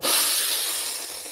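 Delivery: slow, soft, breathy sigh out; long relaxing exhale sigh; long exhaling sigh. Shown as a demonstration. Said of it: "You simply go".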